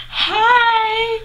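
breath of nervous laughter, then high squealed hi; very high, drawn-out squeal; mock starstruck greeting. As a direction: imitate a flustered fan squeaking loudly. Retells starstruck greeting to her idol.

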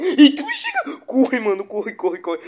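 loud, high panicked yelling; being chased. Real, not acted. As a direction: shout to run, scared stiff. Monster suddenly chasing him.

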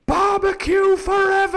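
very high, drawn-out pirate cheer; crew crying out. A narrator's reading. Pirates cheer Long John by his nickname, crying out. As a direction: chant it like a delirious mob. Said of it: "they cried".

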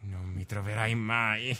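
teasing taunt. Pretend. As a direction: taunt softly from hiding. Taunting the seeker in hiding.

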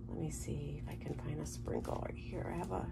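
soft whispered muttering to herself. Real, not acted. Crafter hunting for a sprinkle, whispering to herself. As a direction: drop to a whisper while searching.

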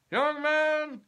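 a self-made boss lecturing a youth; grand old tycoon addressing a young man; pompous company president. Acted. President Kennedy voiced in the story.

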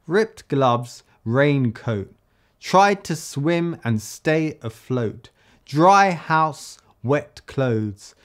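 each pair of syllables tapped out evenly; rhythmic two-beat reading. Shown as a demonstration. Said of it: "you can hear in the way that I read it".

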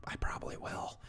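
whispered, almost no voice; whispered aside. Real, not acted. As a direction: slip it in as a secret aside. Aside muttered under his breath.

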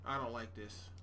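low, deflated mutter; quiet defeated grumbling. Real, not acted. Quiet dismay.

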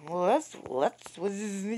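usual speaking voice

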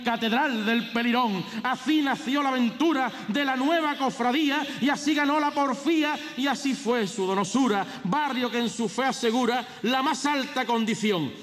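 high, ringing proclamation of verse; pregón herald. Acted. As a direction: declaim it like a festival herald.

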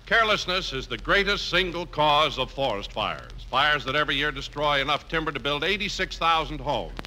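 usual speaking voice

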